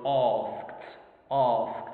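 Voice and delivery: RP "asked" with long aw vowel; British RP accent. Shown as a demonstration. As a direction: say "asked" in Received Pronunciation, vowel as in heart. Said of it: "using the Royal British or the original".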